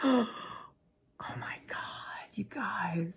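teary whispered awe; overwhelmed surprise. Real, not acted. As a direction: whisper through happy tears. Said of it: "watering up my eyes".

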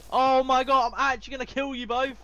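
high, very loud outburst; loud, high-pitched rage; angry yelling. Real, not acted. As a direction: shout in exasperation at your attackers. Gamer shouting at attackers.

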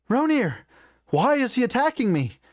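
very high-pitched, panicked cry; alarmed shout. A narrator's reading. Clara dodging an orc's attack, shouting in alarm. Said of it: "she shouts as she dodges".

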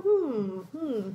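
ordinary talk, nothing marked

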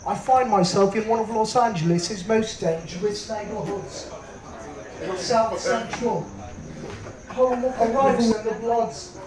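hushed British nature documentary voice; British documentary narrator. Retold or imitated. British guy narrating an LA neighborhood, hushed documentary narrator voice.

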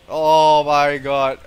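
loud, slow drawn-out cry; real loud alarm; alarmed shout. Real, not acted. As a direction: cry it out in dread. About to pass out in fight.

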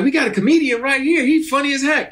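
high-pitched excited announcing, imitated; eager producer. Producer volunteering his friend as comedian, excited boast. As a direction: talk him up like an eager friend.

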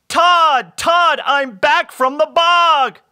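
high, loud, drawn-out shout; storybook shout through the house; calling out. A narrator's reading. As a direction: holler excitedly on coming home. Bob calls out arriving home.

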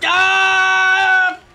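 high sharp yelp of shock; sudden pained race yelp; startled shout. Real, not acted. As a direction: yelp as you get hit.

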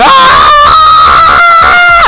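loud, drawn-out power-up scream; battle-cry scream. Real, not acted. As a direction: scream like you are powering up. Screaming with effort while clicking.

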